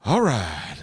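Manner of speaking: big hammed-up film-star delivery; Al Pacino. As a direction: demonstrate his catchphrase style with force. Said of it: "how to do Al Pacino".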